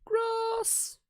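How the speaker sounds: very high squeak; tiny cartoon squeal; squeaky yelp. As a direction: squeal the word.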